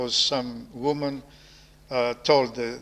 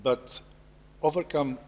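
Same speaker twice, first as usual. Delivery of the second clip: scripture reading. Acted. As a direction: read the verse solemnly, with pauses. Reads Paul's epistle to close.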